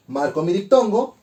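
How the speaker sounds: usual speaking voice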